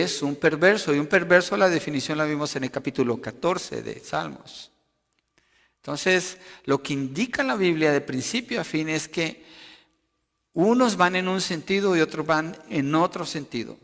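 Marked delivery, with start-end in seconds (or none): none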